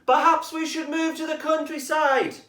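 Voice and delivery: high, loud fox voice; a lively fox wondering aloud; high-pitched cartoon fox. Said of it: "suggested fox number two".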